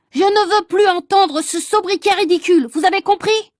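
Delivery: high, loud, angry yell; furious shout. Acted. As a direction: lose his temper at the class. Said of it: "a crié le bouillon qui avait l'air drôlement énervé".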